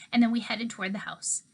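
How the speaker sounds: usual speaking voice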